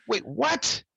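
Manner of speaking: mock double take; retold disbelief. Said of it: "I was like".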